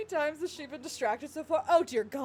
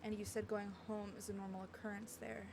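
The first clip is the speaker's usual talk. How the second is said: quiet, gentle line reading; soft dialogue reading; 3 semitones lower, 12 dB quieter than usual. Acted. Heroine recalling what he told her about home, softly. Reads game dialogue aloud.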